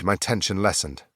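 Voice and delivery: ordinary delivery, nothing marked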